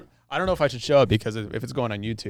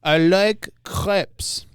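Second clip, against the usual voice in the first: slow, higher hammed-up French accent on I like crepes; mock French accent; 8 semitones higher, slower than usual. Shown as a demonstration. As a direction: mimic a cartoon Frenchman. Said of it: "Just say you like a very thin pancake".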